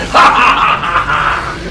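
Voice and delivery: loud maniacal wolf cackle; big laughing roar before the pounce; villainous roaring laugh. Acted.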